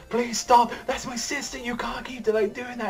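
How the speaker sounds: very high plea that fades to a whisper; high pleading voice sinking to a whisper; desperate pleading. Retold or imitated. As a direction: beg them to stop, breaking down. Brother pleads with monster sister.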